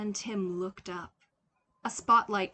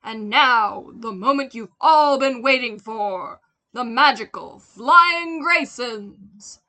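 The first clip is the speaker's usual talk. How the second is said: big booming showman call, louder and higher, slowing on the name; circus ringmaster announcing; 3 semitones higher, 16 dB louder than usual. A narrator's reading. Ringmaster to the circus crowd, booming announcement. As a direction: announce the star act to the whole tent. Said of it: "a performer announced".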